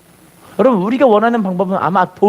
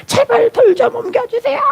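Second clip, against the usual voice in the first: tearful plea. Retold or imitated. Boy prays weeping.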